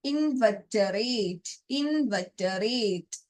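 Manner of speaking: drawn-out, careful repeats of ഇൻവെർട്ടറേറ്റ്; slow dictation. Shown as a demonstration. Teacher to exam students, slow careful dictation. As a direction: slowly spell out ഇൻവെർട്ടറേറ്റ് for students. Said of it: "അത് pronounce ചെയ്യുക".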